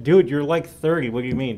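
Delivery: ordinary delivery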